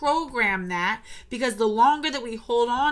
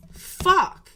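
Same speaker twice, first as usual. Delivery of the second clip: higher, sharp frustrated swear; angry curse; 7 semitones higher, 5 dB louder than usual. Real, not acted. Speaker still mad at the memory, angry curse. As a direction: spit it out in anger. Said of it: "it still makes me mad".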